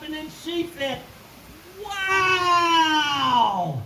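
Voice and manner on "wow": long, high, loud wow sliding down; amazed shout. Acted. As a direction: holler in drawn-out astonishment. Mama sees the towering beanstalk.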